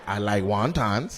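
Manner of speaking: low, slow, drawn-out mock quote; imitated audience member. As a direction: draw it out low, imitating her. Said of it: "She was like".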